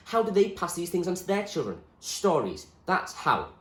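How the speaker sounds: impassioned William. Acted. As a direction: argue with conviction. William defends stories.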